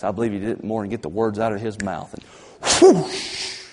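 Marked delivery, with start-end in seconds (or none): 2.8-3.6 high, loud whoosh of fire; whoosh sound. Acted. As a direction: make the fire roar down. Fire falling from heaven.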